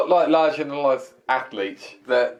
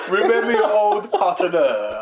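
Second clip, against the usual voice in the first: slow, higher, exaggerated attempt at Stasiak's accent; bad accent imitation; 6 semitones higher, slower than usual. Podcast host mimicking a wrestler to his co-host, failed accent. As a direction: drag out the words in a botched foreign accent. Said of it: "I can't do his accent".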